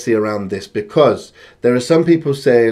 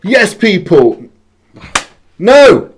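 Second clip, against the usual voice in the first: loud slow drawn-out announcer hype; hype intro shout; 8 semitones higher, 9 dB louder, slower than usual. Real, not acted. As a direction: boom it out like a host. Hype show intro greeting.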